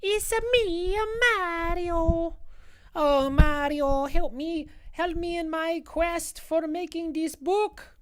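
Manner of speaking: very high cartoon Italian accent; Mario. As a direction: plead in a slow, sing-out falsetto Italian. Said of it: "That's the accent that I kinda rock into".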